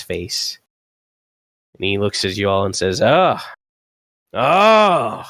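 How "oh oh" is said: put-on high, loud, hearty old man's greeting; grinning old rider. Old horseman greets riders coming back to town, loud and delighted. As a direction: hail returning friends through a big grin. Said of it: "he's got this big, mighty grin".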